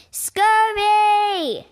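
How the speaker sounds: loud, high, drawn out angry yell; captain bellows at his man. Acted. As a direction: roar his name. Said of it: "Captain Blackbeard yelled".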